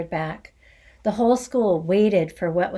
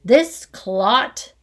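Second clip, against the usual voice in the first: loud booming insult; 3 semitones higher, 6 dB louder than usual. Acted. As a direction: boom it across the hall. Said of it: "boomed the headmistress".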